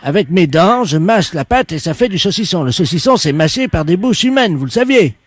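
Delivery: loud, put-on voice of an odd shop worker; a loud prank caller playing it dead serious. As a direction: fake tradesman on the phone, loud.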